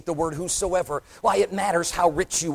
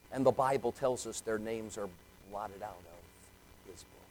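hushed preaching; 10 semitones lower, 16 dB quieter than usual. Acted. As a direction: let the words sink in softly. Dramatic hush in sermon.

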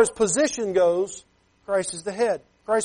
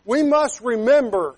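ordinary talk, nothing marked

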